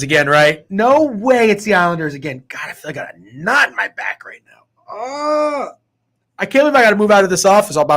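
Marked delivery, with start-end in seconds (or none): 2.5-5.7 strained, groaning voice; pained groan. Real, not acted. Complaining of a knot in his back, groaning. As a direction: groan it out like your back hurts.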